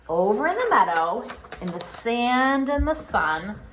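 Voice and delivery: sing-song picture book reading; reading to children. Acted. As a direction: lilt it like a nursery rhyme. Reads rhyming picture book aloud.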